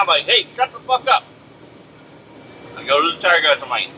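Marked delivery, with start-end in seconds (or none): 0.2-1.2 high-pitched cut-off of a nag; exasperated retort. Retold or imitated. Driver to the pestering caller, exasperated retort. Snapping back at pushy caller.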